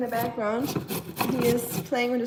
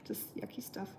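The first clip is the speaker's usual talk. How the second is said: whispered disgust; 3 semitones lower, 16 dB quieter than usual. Real, not acted. Disgust at the boiling gunk.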